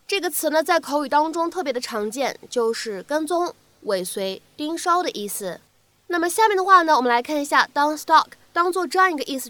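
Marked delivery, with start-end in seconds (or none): none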